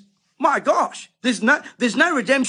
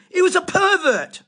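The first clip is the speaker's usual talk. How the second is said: sharply higher, scornful verdict; angry denunciation; 9 semitones higher than usual. Real, not acted. Preacher condemning a celebrated sportsman, angry and high. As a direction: spit out the verdict in disgust.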